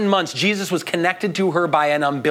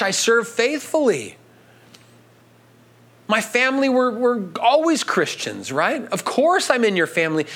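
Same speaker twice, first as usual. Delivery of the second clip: pleading believer; 5 semitones higher than usual. Acted. Pleading believer voiced to Jesus.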